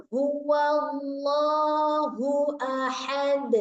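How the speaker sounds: huwallahu drawn very slowly with breathy h; slow recitation. Shown as a demonstration. Teacher recites with a clear h. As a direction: lean on the h of huwallahu. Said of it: "Pakai ha".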